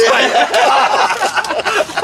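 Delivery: a big high burst of laughter; cracking up at his own punchline; loud high laughter. Real, not acted.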